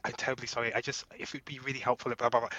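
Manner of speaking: very soft, ineffectual pleading; thick British apology. Shown as a demonstration. Brit trying to get help, meek put-on apology. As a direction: murmur a helpless, over-polite British sorry. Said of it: "lay it on very thick".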